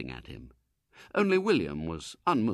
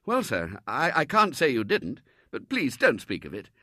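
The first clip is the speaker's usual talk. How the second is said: higher, louder, stammering politeness; flustered young Robert; 5 semitones higher, 6 dB louder than usual. Acted. Robert waving off the Colonel's apology, flustered and high. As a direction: brush it off awkwardly.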